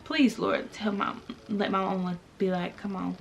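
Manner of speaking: hushed embarrassed prayer to be rescued; praying to the Lord in a restaurant, hushed and mortified; inner embarrassed plea. Retold or imitated. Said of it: "I'm just looking like".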